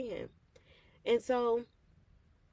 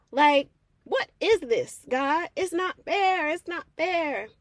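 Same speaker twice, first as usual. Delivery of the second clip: loud, higher whiny protest; indignant complaint; 6 semitones higher, 9 dB louder, slower than usual. Retold or imitated. Speaker protesting to God that it is unfair, loud and whiny. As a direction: stamp your foot, it's not fair.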